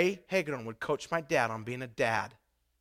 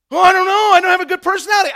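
higher, fast whiny excuse; mock excuse; 17 dB louder, faster than usual. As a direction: mimic the congregation's lame excuse, quick and high. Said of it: "some of you are like".

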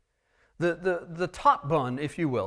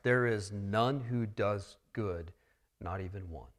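quiet restatement; 6 semitones lower, 6 dB quieter than usual. Acted. Preacher gently repeating the verse to the church, soft. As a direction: lower the voice and let it land.

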